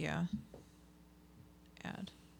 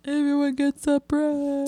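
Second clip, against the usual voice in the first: mock giveaway; 7 semitones higher than usual. Riffing on giveaway bit.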